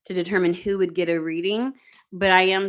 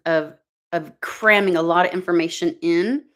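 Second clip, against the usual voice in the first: ordinary talk, nothing marked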